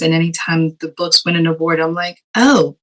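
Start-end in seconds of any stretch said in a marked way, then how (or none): 2.3-2.8 higher, louder dawning realization; lightly acted delighted discovery; retold pleased surprise. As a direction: react as if an award just landed. Retold reaction to winning award.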